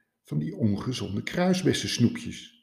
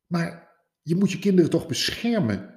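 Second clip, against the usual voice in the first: high, disbelieving plea; incredulous protest; 8 semitones higher than usual. Acted. Rabbit protesting to hedgehog, high and incredulous.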